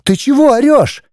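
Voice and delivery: high-pitched outraged protest; indignant cry. A narrator's reading. Wife objecting to husband's yelling, indignant. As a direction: cry out in offense without much volume. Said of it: "возмущённо выкрикнула Светлана".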